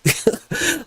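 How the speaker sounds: a short high giggle; sudden high laugh; high squeaky laugh. Real, not acted. Laughs after mock outburst.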